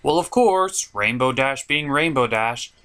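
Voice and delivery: usual speaking voice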